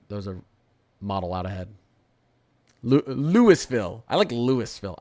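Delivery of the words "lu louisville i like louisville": Louisville with the S spoken, LOO-iss-vill; loud Louisville said as "Lewis-ville"; sounded S. Shown as a demonstration. Streamer playing with his favourite version, sounded S. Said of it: "I think it sounds nice".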